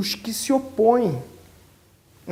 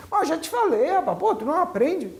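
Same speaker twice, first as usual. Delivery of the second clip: higher-pitched, exasperated mock scolding; impatient scolding; 6 semitones higher than usual. Preacher imitating a short-tempered mentor scolding a disciple, exasperated and higher. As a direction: play the short-fused mentor, annoyed. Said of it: "tem pavio curto".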